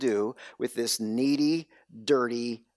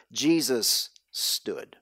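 1 slower, quieter; 3 semitones lower, much slower than usual. Real, not acted. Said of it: "Or even better".

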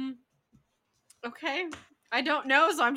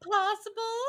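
laughing inner voice; 8 dB louder than usual. Retold or imitated. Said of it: "and I'm just like".

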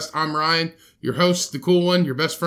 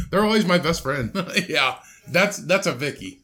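ordinary talk, nothing marked

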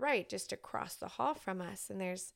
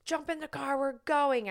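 urgent call; 6 semitones higher, 4 dB louder than usual. Retold or imitated. Urging boys to leave now.

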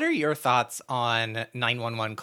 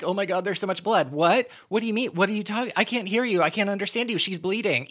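high-pitched, rushed mock hysteria; hammed-up panicked 911 caller; dramatic fake caller; 7 semitones higher, faster than usual. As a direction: play up a caller stalling with frantic questions. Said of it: "They're acting really w-, dramatic".

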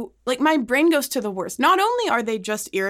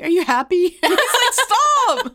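mock cheerful check-in; 7 semitones higher, 5 dB louder than usual. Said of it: "randomly being like".

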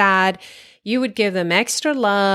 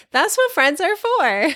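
laughing; 9 semitones higher than usual. Real, not acted. Laughing between laughs about friends.

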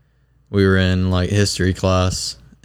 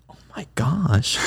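mock shocked gasp; 6 semitones higher, 4 dB quieter, slower than usual. Girl looks back, acted quote.

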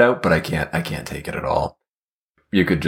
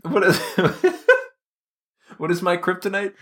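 giggly; 13 semitones higher than usual. Real, not acted. Laughing after a giggle.

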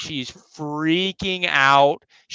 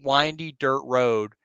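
plain reading voice

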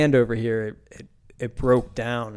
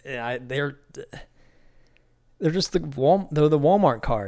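ordinary delivery

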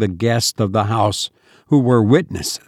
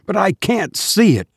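high, panicked insistence on see; desperate cry to Hammond; terrified cry; 7 semitones higher than usual. A narrator's reading. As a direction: stress see in rising fear. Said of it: "I cried".

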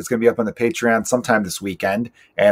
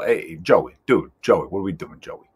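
put-on Chicago Italian accent, a buddy ribbing Joey; Danny Callis impression; 5 semitones lower, 5 dB quieter than usual. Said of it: "that Chicago Italian accent he's got going on".

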